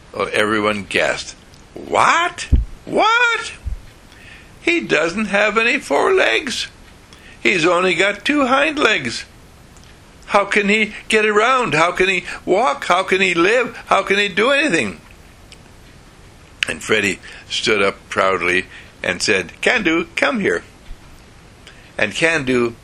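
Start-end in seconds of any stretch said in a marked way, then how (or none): 1.7-15.0 family aghast at the two-legged dog, high shocked voices. Acted. 19.6-20.6 high proud call to the dog; boy calling his dog to show the family, high and proud. Acted. Freddie calls his dog.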